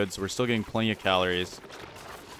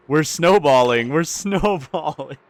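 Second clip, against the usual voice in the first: laughing despair; 5 semitones higher, 7 dB louder, slower than usual. Real, not acted. Collapse spreading.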